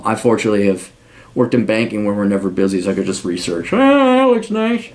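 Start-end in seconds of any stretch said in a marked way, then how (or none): 3.7-4.9 dreamy mock musing. Voicing himself browsing countries.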